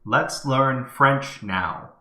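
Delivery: slow, loud, clearly spaced voice command; dictating to microphone. Real, not acted. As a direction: dictate it slowly and firmly to the machine. Let's do it with the microphone.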